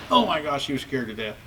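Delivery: ordinary delivery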